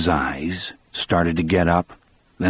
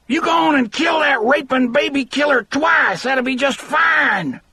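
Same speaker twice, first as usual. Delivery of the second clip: high, ragged, strident shriek; screamed. A narrator's reading. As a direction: shriek it with vengeful fury, drawing out the end. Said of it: "Marjorie Deterick suddenly screamed".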